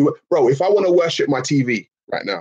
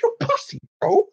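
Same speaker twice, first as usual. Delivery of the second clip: mocking taunt. Taunting chat for not worshipping the light, squeaky mock. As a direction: squeal the insult like a playground tease.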